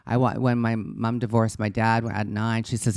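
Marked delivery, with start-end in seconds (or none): none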